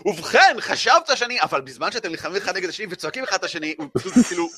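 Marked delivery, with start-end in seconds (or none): 0.0-1.4 higher, louder shout at the dragon; a taunting shout, raised and loud; Yara yelling at him. Acted. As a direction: yell it up in his face. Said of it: "ומתחילה לצעוק אליו".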